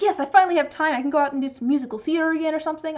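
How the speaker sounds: eager, slightly hammed-up joy; excited. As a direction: gush like you finally got free time. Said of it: "I was like".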